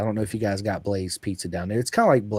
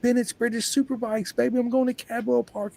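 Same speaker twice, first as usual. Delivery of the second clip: pitched-up bragging to the border guard; proud and excited; 13 semitones higher than usual. Retold or imitated. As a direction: announce it way higher, like a hype man. Proudly telling border guard his trip.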